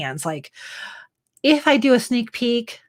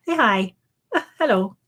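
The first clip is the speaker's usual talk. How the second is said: ordinary delivery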